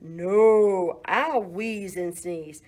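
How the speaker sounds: loud mooed no, then slow; mooing cow. Acted. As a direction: moo a stubborn refusal. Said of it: "The cow mooed".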